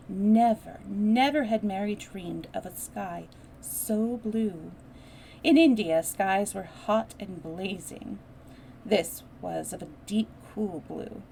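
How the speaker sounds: put-on reading a children's classic aloud; story narration. A narrator's reading. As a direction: tell the story with lively character voices. Reads the novel aloud.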